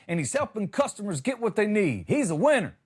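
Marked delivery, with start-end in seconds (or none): none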